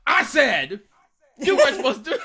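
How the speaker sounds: loud, high-pitched retold outburst; pissed off. Telling off a travel companion for breaking a rule, pissed. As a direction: re-enact being fed up, voice shooting up. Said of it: "I was pissed".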